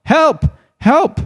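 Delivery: high shrill calls for help; shrill desperate shouts; crying for help. Acted. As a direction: call out into the wind, desperate.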